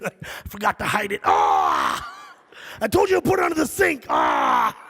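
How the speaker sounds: loud panicked shouts with yelps; panicked host. Pretend. Host panicking as the boss goes to the bathroom, shouting. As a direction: panic that the boss will find it.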